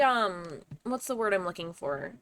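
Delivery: ordinary delivery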